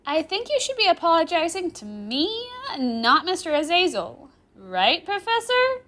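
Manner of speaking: put-on high, loud girl teasing a teacher; taunting. Girl rubbing a professor's mistake in his face, taunting. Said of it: "the girl asks tauntingly".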